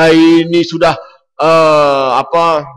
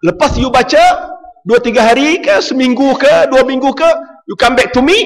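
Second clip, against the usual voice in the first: higher, louder urging; earnest urging; 11 semitones higher, 5 dB louder than usual. Retold or imitated. Preacher urging his neighbour, loud. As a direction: press him warmly to come back. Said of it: "Saya kata".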